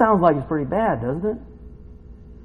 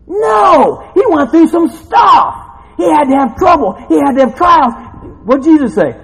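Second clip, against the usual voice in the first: loud, high shouted sermon; full-force preaching; 12 semitones higher, 9 dB louder than usual. Real, not acted. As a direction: hammer each statement home at a shout.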